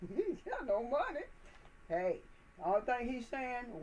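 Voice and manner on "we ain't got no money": lighter, higher playful echo; catchphrase again. Retold or imitated. Repeating the catchphrase to himself with a laugh. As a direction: toss it off with a grin. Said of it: "I like the way he say that".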